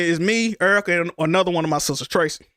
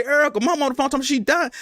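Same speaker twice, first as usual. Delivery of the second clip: high, rushed alert; retold alarm; 7 semitones higher, faster than usual. Son alerting his sleeping sister about Mom, alarmed. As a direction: wake her with urgent news.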